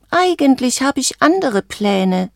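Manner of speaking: high, puffed-up little voice; self-important. A narrator's reading. Frog telling the bird of other plans, self-important. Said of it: "sagte Valentino wichtig".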